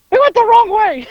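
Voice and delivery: high, exasperated imitation of the driver; very high-pitched mock outburst. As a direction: squawk it out in mock dismay. Imitating driver realizing his mistake.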